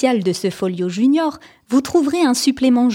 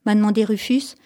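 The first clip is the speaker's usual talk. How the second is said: usual speaking voice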